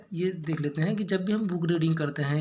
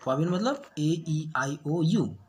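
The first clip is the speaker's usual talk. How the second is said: normal talking voice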